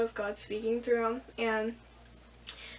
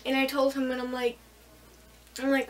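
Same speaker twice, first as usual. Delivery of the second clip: ordinary talk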